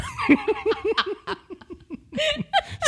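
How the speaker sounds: squealing laugh; high laughter. Real, not acted. Both crack up.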